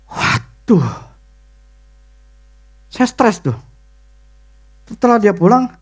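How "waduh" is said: ordinary talk, nothing marked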